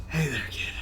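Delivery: lightly voiced wounded old warrior; a hint of a broken knight; dying mentor. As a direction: greet the kid with your last strength. Dying master greets his student.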